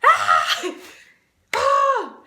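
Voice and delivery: loud cries bursting out; real tearful outbursts; emotional wails. Real, not acted. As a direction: cry out, overwhelmed, in sharp loud bursts. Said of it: "trying very hard not to cry".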